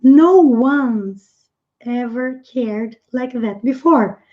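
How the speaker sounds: slowed, clear English phrase; careful teacher pace; slow model reading. Shown as a demonstration. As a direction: say it slowly for the class. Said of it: "teacher repeats clip slowly".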